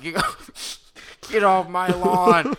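high, loud, slow crazed yell; an old man screaming at intruders; spazzing out. Retold or imitated. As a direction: lose it like a furious old man. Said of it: "spazzing out".

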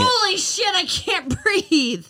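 high gasps between laughter; laughing so hard she can't breathe; breathless laughing. Real, not acted. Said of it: "She's doing it".

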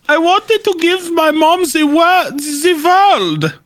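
high, put-on voice for a dictator wanting to please his mom; Hitler voice. Joking that Hitler did it all for his mother, in a high put-on voice. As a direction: pitch it up in a mock German-tinged dictator voice.

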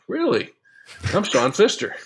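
a high, startled "really?"; jumping up high in pitch on one word; mock surprise. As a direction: feign wide-eyed disbelief. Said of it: "And I'm like".

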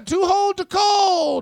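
high, slow shouted line; Billy Graham promo shout. Retold or imitated. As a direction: bellow it like a wrestling promo. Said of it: "You see why they're like".

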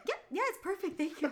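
high, light, amused reassurance, imitated; retold amused customer. Customer humoring the clueless bartender, imitated amused thanks. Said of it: "he's just, like, laughing".

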